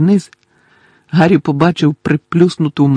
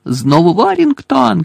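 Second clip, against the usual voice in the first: higher, drawn-out call; commentator shouting; 5 semitones higher, 3 dB louder than usual. Acted. Commentator spots Warrington with the ball, shouting. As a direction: call the player's name out to the stands. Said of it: "кричав Лі".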